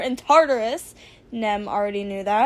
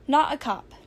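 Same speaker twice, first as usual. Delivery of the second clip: ordinary delivery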